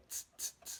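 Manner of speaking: tss hissed soft and voiceless; quiet hissing tss like a cymbal; soft unvoiced tss; soft tss hi-hat. Shown as a demonstration. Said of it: "you say".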